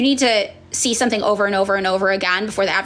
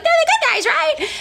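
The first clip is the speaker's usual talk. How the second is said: much higher, wide-eyed mock voice; naive believer. Mocking a naive young liberal, high wide-eyed voice. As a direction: play it gullible and hopeful.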